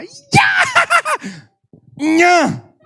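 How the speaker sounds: very high, loud squeal of joy; joyful shout. Retold or imitated. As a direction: squeal with delight. Sabe aquela coisa boa?. (grito agudo de alegria)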